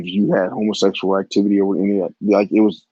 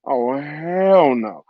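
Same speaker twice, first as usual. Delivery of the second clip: indignant refusal; 5 semitones higher, much slower than usual. Retold or imitated. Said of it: "and I'm like".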